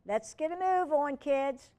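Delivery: loud, high call across the room. Acted. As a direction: call the kids to hurry. Sam calling to kids.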